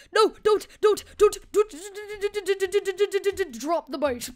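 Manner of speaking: high machine-gun stammer into the drop; stuttered build-up. Pretend. Rapid-fire stuttered build to drop.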